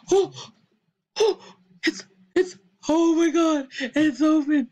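high, loud squeal of joy, voice cracking; thrilled shout. Real, not acted. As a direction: burst out in delighted squealing. Option finally opens, thrilled.

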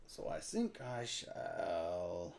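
put-on low, slow, soft French accent; deep drawn-out fake French; weird French voice. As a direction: muse slowly as a mock Frenchman. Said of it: "you get to talk in a weird voice".

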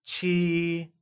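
Qi held high and level. Shown as a demonstration. Teacher modelling Qi with a high level tone for learners. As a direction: keep Qi flat and high. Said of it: "said with a high level tone".

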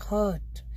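हर्ट with no R sound; silent R. Shown as a demonstration. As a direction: drop the R in हर्ट. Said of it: "फिर से silent हो रहा है".

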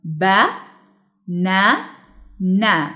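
"با، نا، نا", each syllable alone with a pause after it; three separate held beats, "با، نا، نا"; split into syllables. Shown as a demonstration. Teacher dividing banana in three, "با، نا، نا" syllable by syllable. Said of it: "لأن هي متقسمة لتلاتة".

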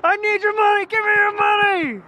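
high, squeaky fake robber; mock robber. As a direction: play a silly stick-up. Playful robber voice to the dog.

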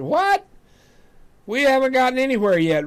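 startled retort. Retold or imitated. Husband balking at his wife's request to stop, in a high startled outburst. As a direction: jump up in pitch like you can't believe it.

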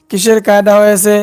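ordinary talk, nothing marked

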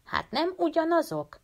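lightly voiced young girl, high and puzzled; a hint of a high girlish question; girl Frieda asking. A narrator's reading. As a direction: Frieda asks the boys, puzzled. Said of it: "kérdezte Frieda".

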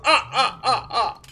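counting vampire laugh; Count Von Count. Acted. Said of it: "That's my Count Von Count impression".

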